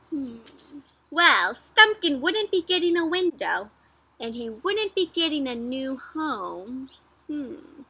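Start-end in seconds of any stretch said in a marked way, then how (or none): 1.1-6.9 put-on slow, gentle read-aloud to little kids; storybook reading. A narrator's reading. Teacher reading the story to her class, slow storybook voice. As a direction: read it like a bedtime picture book.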